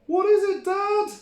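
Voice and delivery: put-on very high, loud child voice; excited kid goat. A narrator's reading. Billy the Kid begs dad, high and loud. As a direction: squeal it out eagerly. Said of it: "asked the Billy Goat boys".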